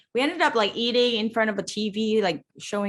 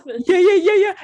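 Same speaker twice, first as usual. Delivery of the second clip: excited; 9 semitones higher, 10 dB louder than usual. Real, not acted. Excited agreement.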